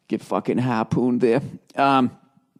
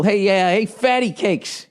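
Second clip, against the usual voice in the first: higher teasing call-out; mock tough love; 7 semitones higher, slower than usual. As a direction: needle them playfully, stretching the nickname. Voicing wife's mock tough love.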